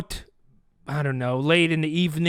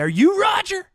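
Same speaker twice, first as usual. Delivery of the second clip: high-pitched shouted demand; acted irate challenge; angry confrontation; 14 semitones higher, 4 dB louder than usual. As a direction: accuse him in a raised, high voice. Confronting the other cameraman.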